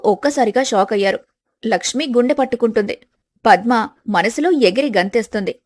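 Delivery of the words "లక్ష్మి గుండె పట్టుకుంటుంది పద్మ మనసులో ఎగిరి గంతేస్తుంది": usual speaking voice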